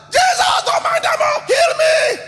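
very high, loud pleading shout; shouted begging plea; desperate cry. Acted. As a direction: cry out over the crowd to be heard. Blind man cries out over crowd.

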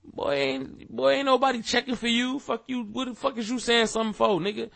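high, scornful brush-off, pitch jumping up; dismissive telling-off. Retold or imitated. Fredo brushing off a critic, high and scornful. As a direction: wave him off with contempt. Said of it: "[name] said something like".

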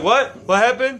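high, mock-startled question; confundido, sobresaltado. Acting someone startled awake by lecture.

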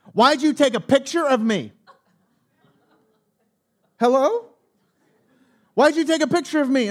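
imitating the angry stranger's accusation; accusing woman. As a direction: demand an answer like she's outraged. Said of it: "there's a woman".

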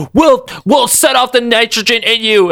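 high mock shout of a threat. Mission control threatening Omega Red, high mock shout. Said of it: "Mission Control's like".

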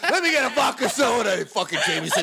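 high, loud mock insult; hammed-up rude bartender; mock yell.

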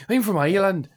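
high, put-on Irish accent; hammed-up Irish lilt, pitched well up. Shown as a demonstration. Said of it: "the Scottish and Irish one".

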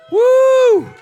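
high shouted whoop of joy; whooping from the pulpit; excited whoop. Real, not acted. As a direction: let out a loud celebratory woo.

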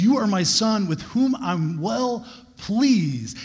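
slow, lifted, solemn proclamation; voice of God. Acted. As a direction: announce it slowly from above, high. God speaks to Jesus.

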